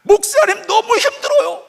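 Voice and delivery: put-on high, whiny voice of a weeping young woman; mock tearful wail; imitating crying young woman. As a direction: play the heartbroken girl complaining to the pastor, pitched high. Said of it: "울면서 막 저한테 소리 질렀어요".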